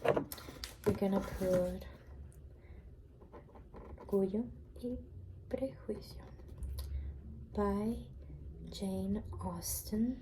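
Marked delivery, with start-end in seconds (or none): 5.5-10.2 library whisper. Real, not acted.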